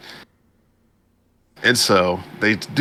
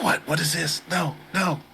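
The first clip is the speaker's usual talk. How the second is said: high, hushed disbelief; small high-pitched voice of shock; mock flipping out; 8 semitones higher, 3 dB quieter than usual. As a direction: imitate a lady in disbelief, softly. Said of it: "the lady, she flips out".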